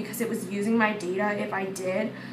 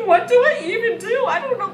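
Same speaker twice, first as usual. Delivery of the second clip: shrieking, laughing, much higher and louder; giddy panic; 12 semitones higher, 10 dB louder than usual. Real, not acted. Fan gets a DM from Jacob, squealing with laughter. Panicked joy at backstage invite.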